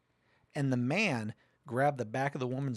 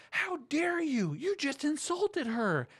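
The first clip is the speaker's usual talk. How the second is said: high, slow, prissy how dare you; mocking imitation of outraged panelists; mock outrage; 14 semitones higher, slower than usual. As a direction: parody the offended panel, drawn out.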